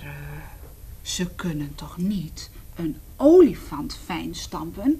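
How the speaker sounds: frightened whispered question; anxious whisper. Acted. Reading to children, the elephant asks anxiously. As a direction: ask it fearfully in a hush. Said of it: "vroeg de olifant benauwd".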